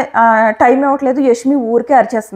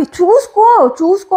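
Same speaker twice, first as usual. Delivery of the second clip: a mock yell, pitched up on the first "చూసుకో"; mild mock shout of "చూసుకో"; imitated shout; 5 semitones higher, slower than usual. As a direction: echo her sharp yelling as a half-hearted mock. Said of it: "అని అరిచింది".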